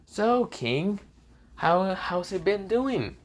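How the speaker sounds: taunting. Acted. Hollow self taunts the king.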